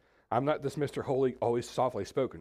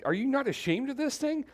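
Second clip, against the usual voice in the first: much higher, pitched-up exasperated demand; raised voice; 14 semitones higher, 3 dB louder than usual. Shown as a demonstration. Preacher voicing Job to his friends with a raised, exasperated voice. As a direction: push it up into a near-shout. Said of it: "let me try to do it two ways".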